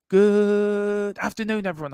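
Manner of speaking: high, loud, long-drawn first word; hammed-up greeting. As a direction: stretch the greeting out high and showy. Hammed-up reading of viewer's greeting.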